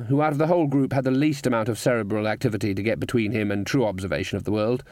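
ordinary delivery, nothing marked

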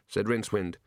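ordinary delivery, nothing marked

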